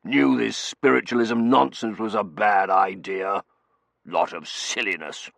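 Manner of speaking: slow, blustering disapproval; loud start, settling into a slow grumble; gruff shorthand colonel. A narrator's reading. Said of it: "speak in a sort of shorthand".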